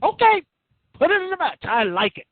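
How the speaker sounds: imitating the general manager approving the stipulation; mock general manager. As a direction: play the boss waving it through. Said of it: "the acting general manager said".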